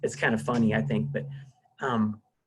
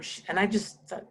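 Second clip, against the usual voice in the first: usual speaking voice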